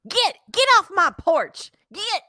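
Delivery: high, loud, angry shoo; shouting at trespasser. Acted. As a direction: yell them off the porch. Grieving resident shoos pollster away.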